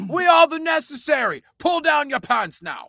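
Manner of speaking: shouting Brit pop frontman. Pretend. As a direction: yell to the crowd like a rock star. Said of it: "the name of my Brit pop band".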